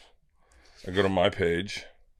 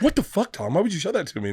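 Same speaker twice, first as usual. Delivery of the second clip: retold dismay; 9 semitones higher, faster than usual.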